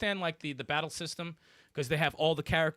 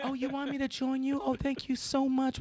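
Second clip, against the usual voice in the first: sweet polite healer; 7 semitones higher than usual. Retold or imitated. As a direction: play her sickly sweet and grateful. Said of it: "And then when you see her".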